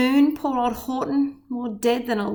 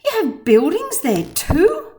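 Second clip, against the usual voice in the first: amazed; 6 semitones higher, 5 dB louder than usual. A narrator's reading. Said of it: "Horton gasped".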